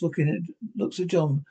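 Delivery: plain reading voice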